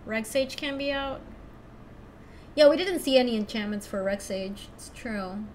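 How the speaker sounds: ordinary talk, nothing marked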